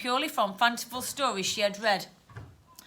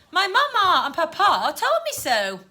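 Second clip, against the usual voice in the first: put-on high, prim upper-class child; posh, raised-pitch assertion; posh girl; 6 semitones higher, 4 dB louder than usual. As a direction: insist like a well-bred little girl. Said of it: "how posh the girl was".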